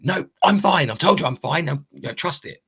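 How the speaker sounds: high, loud insistent denial; pushing it back: I'm fine; insisting I'm fine. Retold or imitated. To a worried friend, high loud insisting I'm fine.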